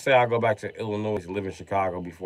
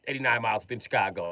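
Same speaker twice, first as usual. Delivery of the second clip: softer, quick grumble in normal pitch; subdued repeat; 6 dB quieter, faster than usual. Acted. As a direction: drop the outrage and say it fast, under the rant. Drops to softer repeat of complaint.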